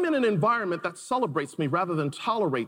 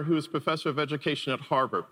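ordinary delivery

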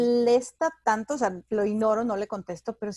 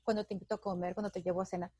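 mock sleazy flirt; 4 semitones lower, 7 dB quieter than usual. Mocking flirty married men.

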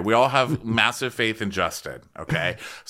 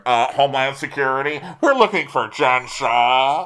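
raised, hammed-up lawman voice; mock officer announcement; 5 semitones higher, 5 dB louder than usual. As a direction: call it out like an agent arriving, stretching the end. Acting officer announcing himself over scene.

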